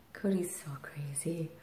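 soft whispered aside. Real, not acted. Whispering an aside about parrot Cody. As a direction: breathe it out in a whisper.

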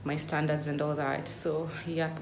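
ordinary talk

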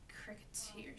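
hushed, whispered contempt, rising; scornful. A narrator's reading. Mother pauses over the insect, whispered scorn. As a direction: say it very softly, with disdain. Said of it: "she said scornfully".

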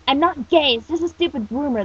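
irritated loud protest; mildly angry. Acted. Disgruntled teen denies rumor to his boss, mildly angry. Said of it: "disgruntled teen muttered angrily".

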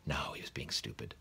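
breathy inner whisper to himself. A narrator's reading. Mr Dursley scolds himself in his head, whispered. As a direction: hush it, talking himself down.

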